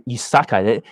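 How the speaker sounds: usual speaking voice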